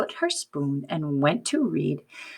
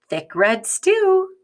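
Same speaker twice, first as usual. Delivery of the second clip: slow, higher, relishing each word; savoring the words; 8 semitones higher, 3 dB louder, slower than usual. Acted. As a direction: linger on the stew. Story refrain said slowly.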